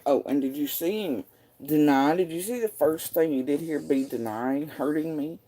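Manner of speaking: ordinary talk, nothing marked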